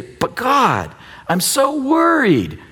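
high, slow whining worrier; mock worried whine. Pastor mimics a congregant's fretful prayer, slow high whine. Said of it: "And we say".

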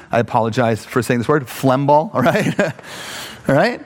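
ordinary talk

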